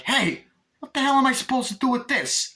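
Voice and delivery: very high-pitched acted complaint; exasperated mock yell. Chucky there as she runs in, high exasperated yell. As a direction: call it out high, in mock outrage. Chucky yelling in retold scene.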